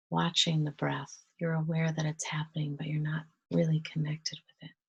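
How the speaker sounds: soft, hushed meditation voice with long pauses; quiet, unhurried breath-led calm; guided meditation. Real, not acted. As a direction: gently guide them into relaxation, leaving space to breathe.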